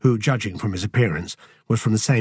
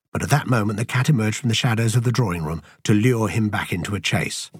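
ordinary delivery

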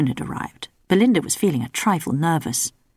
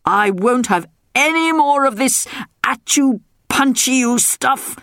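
protesting king; 7 semitones higher, slower than usual. A narrator's reading. King protesting as his daughter advances. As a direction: back away protesting like a king.

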